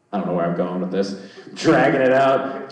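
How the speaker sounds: normal talking voice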